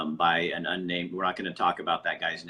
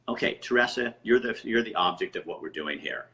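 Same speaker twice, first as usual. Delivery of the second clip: ordinary delivery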